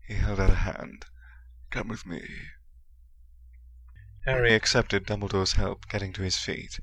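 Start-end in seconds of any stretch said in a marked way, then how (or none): 1.7-2.3 much softer gentle invitation. A narrator's reading. Dumbledore gently tells shaken Harry, quietly. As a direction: say it gently, almost under the breath. Headmaster gently leads boy away.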